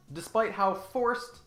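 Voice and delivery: ordinary delivery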